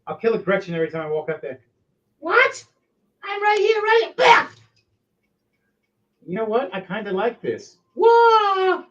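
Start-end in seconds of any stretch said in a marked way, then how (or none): 2.2-4.5 much higher silly voice; squeaky orc. Acted. The odd orc answering the queen, squeaky silly voice. 8.0-8.8 silly squeal. Acted. Orc's cry.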